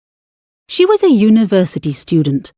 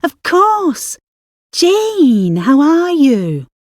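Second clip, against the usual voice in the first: surprised greeting; 7 semitones higher, much slower than usual. Acted. Annie greets her old friend.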